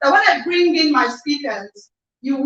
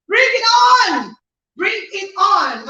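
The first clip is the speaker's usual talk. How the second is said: hype shout; 6 semitones higher than usual. Real, not acted. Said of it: "host hyping live audience".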